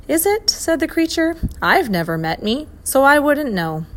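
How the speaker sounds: high, loud befuddled oink voice; puzzled creature. A narrator's reading. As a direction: sound genuinely baffled by yourself.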